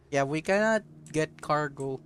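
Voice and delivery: ordinary talk, nothing marked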